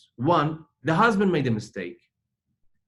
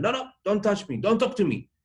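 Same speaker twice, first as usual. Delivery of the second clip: higher-pitched retold refusal, upset; upset rebuff; 9 semitones higher than usual. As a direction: push him away, voice raised in pitch. Upset wife rebuffs her husband.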